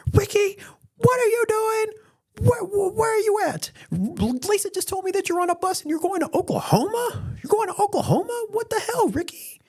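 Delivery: very high, frantic mock-mom falsetto; typical mom. Mom phoning her runaway son, high-pitched hammed-up alarm. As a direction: squeal questions like an alarmed mother. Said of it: "you know, typical mom".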